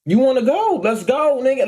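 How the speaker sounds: high-pitched, eager mock challenge; acted fighting challenge. Daring the driver to fight, acted high-pitched challenge. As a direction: replay egging him on to fight.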